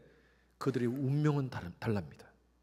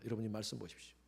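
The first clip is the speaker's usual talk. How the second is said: ordinary talk, nothing marked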